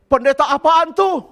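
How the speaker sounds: high, loud taunting shout; accusing yell. Retold or imitated. Preacher as son yelling at his pastor father, accusing yell. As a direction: shout it as an outraged child. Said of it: "Dia teriak".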